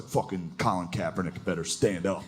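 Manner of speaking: low, soft gruff mutter. Retold or imitated. Hometown guy tweeting, low gruff grumble. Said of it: "someone from back home that's just like".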